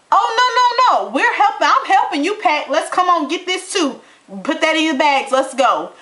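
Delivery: hammed-up cheerful eviction; gleeful mock helper. Acting out her own eviction.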